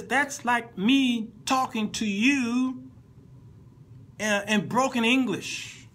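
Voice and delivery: high, slow, emphatic reenactment; acted emphatic objection. Acts guitarist explaining bad translation.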